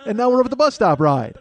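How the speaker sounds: put-on high, loud, thrilled kid announcing rides; excited little kid. Five-year-old brother treating the ordeal as amusement park, excited. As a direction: imitate a five-year-old delighted by every stop. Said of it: "He's like".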